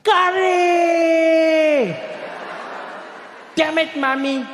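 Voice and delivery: high, long-held scream on the first word; top-of-lungs scream. Retold or imitated. Comedian mimics school friend opening his sandwich, top-of-lungs scream. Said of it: "scream at the top of his lungs".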